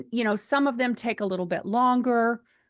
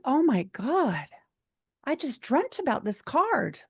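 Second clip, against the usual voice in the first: amazed disbelief; 6 semitones higher than usual. Retold or imitated. Stunned at her dream coming true.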